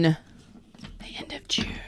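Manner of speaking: soft slow whisper, almost no voice. Shown as a demonstration. Tarot reader whispering the date to viewers. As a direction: lean in and breathe it slowly.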